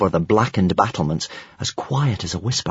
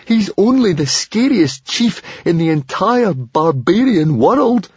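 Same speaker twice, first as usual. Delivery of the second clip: slow, high, worried voice; high-pitched, drawn-out dread; anxious; 9 semitones higher, 3 dB louder, slower than usual. A narrator's reading. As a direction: fret aloud, drawing out the words. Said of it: "in his anxiety".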